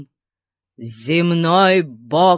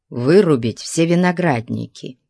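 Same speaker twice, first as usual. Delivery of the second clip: plain reading voice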